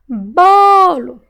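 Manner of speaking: "Bolo" with a loud, high, long BO; stretched stressed syllable. Shown as a demonstration. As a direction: call out "Bolo", holding BO. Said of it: "Qual que eu demorei mais falar".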